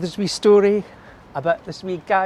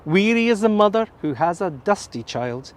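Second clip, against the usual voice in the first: poem recitation; 3 semitones higher, 3 dB louder than usual. Shown as a demonstration. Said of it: "I'll do it in English now".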